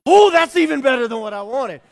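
put-on high, loud burst of kid joy; delighted surprise. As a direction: shout it like a kid who just got a better gift. Kid opens surprise gift.

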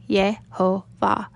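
"Yehovah" slowly, split as Ye, ho, vah; syllable by syllable. Shown as a demonstration. Teacher shows how the name is read, "Yehovah" syllable by syllable. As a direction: sound out "Yehovah" in three separate slow beats. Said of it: "it should be read as".

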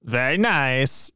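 lightly voiced catchphrase impression, drawn out; Borat. Host slips into movie intro catchphrase, light Borat impression. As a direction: stretch it slowly as a light impression. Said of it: "do the intro as Borat".

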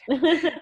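bright laugh at herself; laughing at her own outburst; burst of laughter. Real, not acted.

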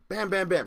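quick high volley of bams; imitated slamming. Storyteller recapping how the safe was pounded, rapid slams. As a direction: fire off three rapid blows.

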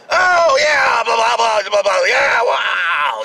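high, loud fake cheering; hammed-up applause shouts; mock crowd cheer.

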